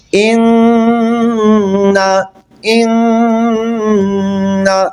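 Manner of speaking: اِنَّا with a long humming nasal nn, very slow; full nasal ghunna. Shown as a demonstration. Teacher models a full ghunna on اِنَّا. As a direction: hold the nn of اِنَّا as a long hum. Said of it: "خوب غنہ".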